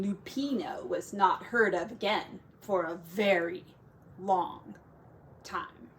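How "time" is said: plain reading voice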